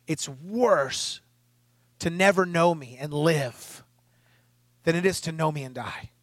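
lightly voiced God, grave and deliberate; God's heartbroken words. God telling the preacher of the lost, slow and sorrowful. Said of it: "he goes".

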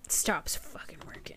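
unvoiced frustrated whisper. Real, not acted. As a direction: hiss the punchline under breath. Punchline dropped.

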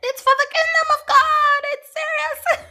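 high, loud laughing mock shout; hammed-up squeal of insistence; comic laughing shriek. Over-the-top mock insistence.

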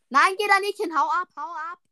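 yelling in high alarm; panicked shout. Real, not acted.

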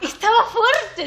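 high, loud, slow laughing repeat of the line; laughing mockery. Real, not acted. Host mocking a bad translation to her cohost, laughing loud and high. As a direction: cackle it out in disbelief. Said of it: "por qué no disfruté este libro de mierda".

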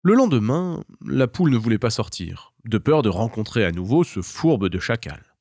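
plain reading voice

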